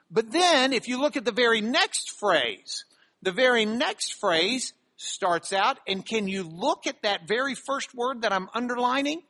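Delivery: ordinary talk, nothing marked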